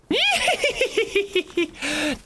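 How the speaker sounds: high cackling witch laugh; witch cackle. Acted. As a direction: cackle like an old hag. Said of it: "Start with witch".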